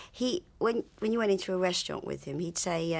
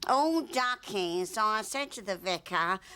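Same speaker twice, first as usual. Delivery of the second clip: loud showy voice; 3 semitones higher, slower than usual. Retold or imitated. Friend imitates bored actor wanting to be recognised, loud showy voice. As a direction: imitate an attention-seeking actor, opening high and drawn out. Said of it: "that voice got louder and louder".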